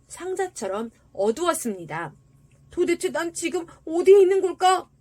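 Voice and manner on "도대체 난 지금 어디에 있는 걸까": high, loud wondering; bewildered tin soldier. A narrator's reading. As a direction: voice the lost soldier wondering aloud. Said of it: "양철 군인은 생각했습니다". (높고 큰 소리로 어리둥절해하는 양철 군인)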